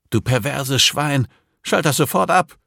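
high, loud disgusted outrage; outraged yell. A narrator's reading. Max orders Slavo to switch it off, outraged yell.

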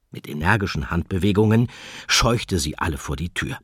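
ordinary delivery, nothing marked

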